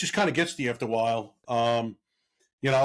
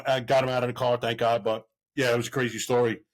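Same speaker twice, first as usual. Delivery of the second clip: ordinary delivery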